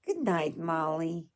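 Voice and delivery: ordinary delivery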